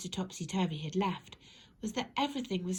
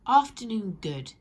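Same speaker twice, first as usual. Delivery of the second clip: Mister Topsy-Turvy voice; 2 semitones higher, 9 dB louder than usual. Acted. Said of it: "said Mister Topsy-Turvy".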